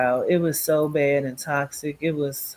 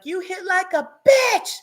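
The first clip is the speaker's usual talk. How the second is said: shrieking defiant taunt, voice cracking; screaming taunt; 14 semitones higher than usual. Retold or imitated. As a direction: scream the taunt at him through tears. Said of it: "I just was screaming".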